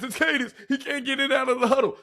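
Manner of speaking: usual speaking voice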